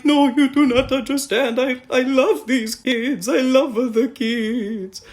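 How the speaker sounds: high pleading villain, drawn out at the end; creepy doll maker voice. Retold or imitated. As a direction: plead like an unhinged villain who adores the kids. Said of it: "the dude's like".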